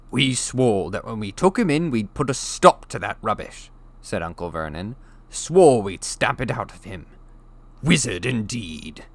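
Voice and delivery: sneering angry uncle; contemptuous. A narrator's reading. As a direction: spit the words with disgust. Vernon sneers.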